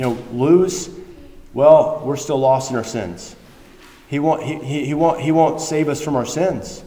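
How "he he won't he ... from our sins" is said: ordinary delivery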